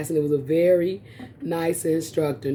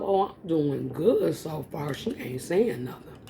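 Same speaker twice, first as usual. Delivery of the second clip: quiet inner thought; 4 semitones lower, 6 dB quieter than usual. Retold or imitated. Inner thought during test.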